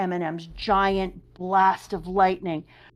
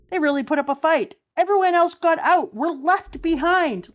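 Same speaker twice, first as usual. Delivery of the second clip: put-on high, loud boyish shout; Luffy's voice; 10 semitones higher, 6 dB louder than usual. Luffy calling out from inside the snake, high and loud. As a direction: pitch it way up like an excited kid yelling.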